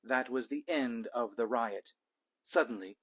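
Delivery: ordinary delivery, nothing marked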